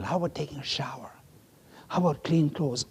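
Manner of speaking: quick whispered question. Real, not acted. Preacher listing the leper's lost comforts, whispered. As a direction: drop to a rushed whisper.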